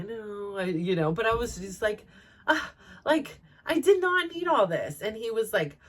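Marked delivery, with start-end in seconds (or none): 2.5-5.0 high, fed-up retort; mock annoyed sigh of a reply; exasperated. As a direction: throw up your hands, mildly imitating yourself. Retelling her own exasperated reply.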